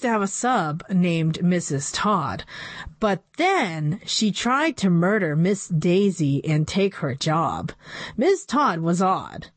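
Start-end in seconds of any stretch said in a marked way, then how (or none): none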